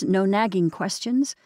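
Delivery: plain reading voice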